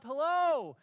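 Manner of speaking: loud high call into the tree; bunny calling up a tree to the squirrels, loud and high; hollering. Retold or imitated. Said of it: "he hollers up".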